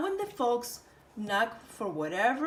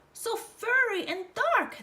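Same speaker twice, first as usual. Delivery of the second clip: much higher amazed; 11 semitones higher, 3 dB louder than usual. Acted. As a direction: marvel at it. Roja remarking ears.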